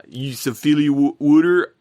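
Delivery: botched attempt at a Philly accent. Shown as a demonstration. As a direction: try a Philly accent and stumble over it. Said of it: "I can't do a Philly accent".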